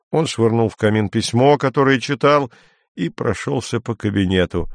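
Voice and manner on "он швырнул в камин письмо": ordinary delivery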